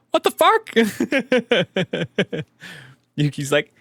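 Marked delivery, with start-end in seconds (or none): 0.1-0.7 sudden very high, loud disbelief; shocked exclamation. Real, not acted. Player reacting to the news, shocked outburst. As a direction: blurt it out, stunned.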